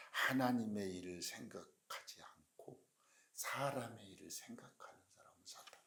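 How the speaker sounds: hushed warning. Real, not acted. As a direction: reveal it gravely, very softly. Reveals Satan's identity in hushed voice. (느리고 낮게 속삭이듯 경고)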